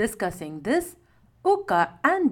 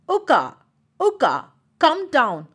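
raised, louder calling up; shouting; 4 semitones higher, 5 dB louder than usual. A narrator's reading. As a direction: call your friend down, loud. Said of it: "shouted Joju".

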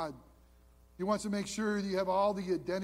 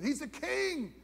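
normal talking voice

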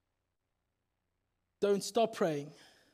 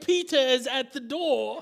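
laughing, louder cry of news; excited exclaim; 3 semitones higher, 5 dB louder than usual. Acted. Servant girl Rhoda to the praying crowd, excited laughing call. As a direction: burst out with the good news.

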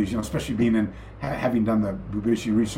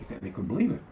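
ordinary talk, nothing marked

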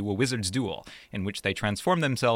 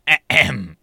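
loud pointed ahem; 4 semitones higher, 11 dB louder than usual. Pretend. Pointed throat clear to co-host.

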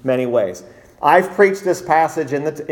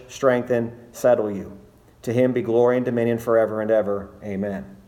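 scripture reading; 4 semitones lower than usual. Acted. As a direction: read the verses reverently, low and measured. Scripture reading before sermon.

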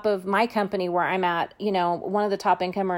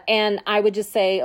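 ordinary delivery

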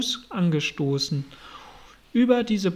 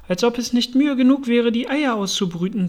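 lightly voiced, higher-pitched grumble; aggrieved pigeon; 5 semitones higher, 4 dB louder than usual. A narrator's reading. Harried pigeon hatching eggs complains, aggrieved. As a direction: complain in a raised, put-upon voice.

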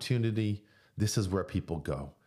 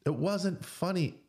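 slow, firm, still raised; slower insistent repeat; 11 semitones higher, slower than usual. Real, not acted. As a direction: land it slowly, insisting. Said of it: "repeated with emphasis".

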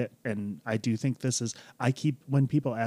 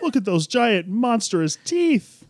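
mock terror; 10 semitones higher, 6 dB louder, slower than usual. Mocking terror at tiny Bidoof.

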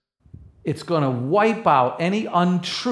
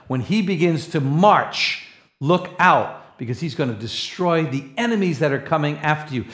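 ordinary talk

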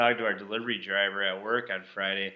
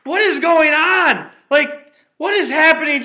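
put-on panicked old lady; 12 dB louder than usual. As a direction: play the lady losing it over the rain. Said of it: "having a meltdown".

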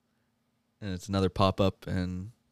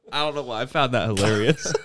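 ordinary talk, nothing marked

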